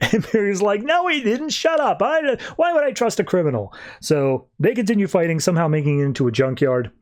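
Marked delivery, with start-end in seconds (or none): 0.8-3.7 high-pitched mock denial; defensive retelling. Barry refusing to believe a criminal, mock defensive. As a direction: argue back in a much higher voice. Retold Barry angrily denies it.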